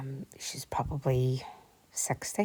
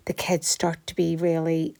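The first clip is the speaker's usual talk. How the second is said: normal talking voice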